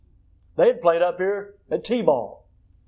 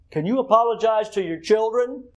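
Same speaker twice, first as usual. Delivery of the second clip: usual speaking voice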